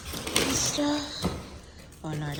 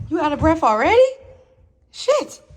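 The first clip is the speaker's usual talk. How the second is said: teasing; 7 semitones higher, 9 dB louder than usual. Real, not acted. Teasing panting dog.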